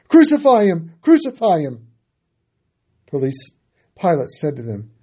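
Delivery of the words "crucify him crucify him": loud, much higher shout; crowd crying out. A narrator's reading. Chief priests crying out to Pilate, loud furious yell. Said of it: "they cried out".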